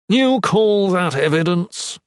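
loud, drawn-out derision; derisive. A narrator's reading. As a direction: throw it back with open scorn. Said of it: "he said with derision".

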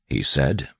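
usual speaking voice

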